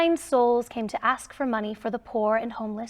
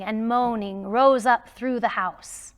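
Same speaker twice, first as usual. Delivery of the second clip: ordinary delivery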